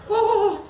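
high squeaky cartoon cackle; cartoon laugh. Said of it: "Who does this sound like?".

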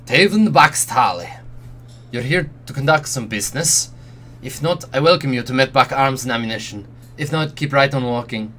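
put-on burly patriarch in a rough try at a Romani accent; attempted Romani accent. Arms dealer greets a customer, improvised Romani accent. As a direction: deliver a hearty accented welcome as a Romani patriarch. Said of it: "His voice is deep and resonant".

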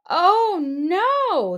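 high, loud mock cry; mock alarm. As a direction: squeal it in fake alarm.

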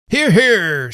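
loud, high cheer of agreement; encouraging approval. A narrator's reading. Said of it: "in a tone of encouragement and approval".

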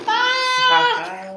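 high squeal; pretend delighted squeal. Storyteller squeals on cue at the silly rhyme. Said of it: "laugh like it is the silliest".

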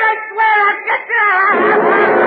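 sobbing vow of revenge as he's hauled off; crying shout. Acted. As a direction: wail the oath through tears. Final threat while dragged out.

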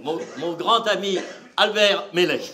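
low, soft introduction of the next act; stage introduction. Real, not acted. Comedian introduces his friend to the audience, soft and low. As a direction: hand over the stage gently.

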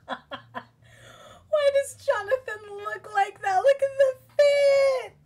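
very high, slow, laughing shriek; laughing squeal. Real, not acted. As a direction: shriek through laughter at the sight. Shocked at the teacher's outfit.

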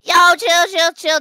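loud, high, playful plea to stop; alarmed protest. Pretend. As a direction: blurt it out in mock panic. Protesting being pushed.

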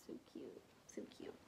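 soft unvoiced whisper, trailing off; whispered repeat. Real, not acted. Unboxing a gift, repeating the praise in a whisper. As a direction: breathe it out as a private aside.

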